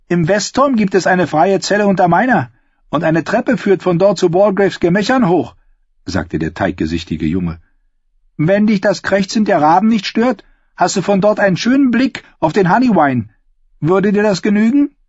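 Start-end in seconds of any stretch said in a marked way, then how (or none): 0.1-5.6 put-on much higher, louder boyish voice; eager young novice, voice pushed up; doughy youth voiced. The pale novice offers Sam a cell, in a high boyish voice. 8.4-14.9 put-on much higher, louder boyish voice; eager young novice, voice pushed up; doughy youth voiced. The pale novice offers Sam a cell, in a high boyish voice.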